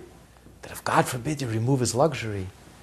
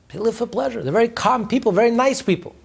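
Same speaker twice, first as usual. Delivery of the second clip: lifted higher, dreamy echo; higher repeat; 10 semitones higher than usual. Shown as a demonstration. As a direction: float the line up high. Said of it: "And they live for pleasure".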